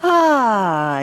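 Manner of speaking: high, loud released sigh of ah; sung sigh. Shown as a demonstration. Teacher showing the heart chakra sigh. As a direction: let out a big open ah. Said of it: "Ah is the exercise for it".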